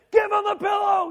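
full-blown furious shout, pitched high; screaming outburst. Retold or imitated. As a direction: blow up and scream it at the kids. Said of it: "I'm just screaming".

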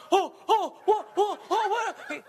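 hammed-up churchgoers stunned by cussing; shocked crowd gasps. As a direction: gasp like a scandalized congregation. Crowd reacting to cussing pastor.

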